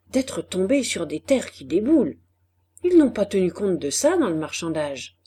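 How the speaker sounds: ordinary delivery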